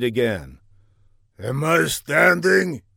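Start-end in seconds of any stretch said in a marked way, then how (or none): 1.4-2.8 put-on slow, slurring drunken question; drunk belching. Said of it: "he belched".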